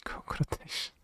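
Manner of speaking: hushed whisper. Real, not acted. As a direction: breathe the words out. Whispered repeat of the phrase.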